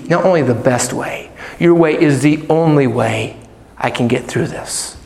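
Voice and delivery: hushed sincere prayer. Shown as a demonstration. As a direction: pray it gently to God. Models a prayer of surrender.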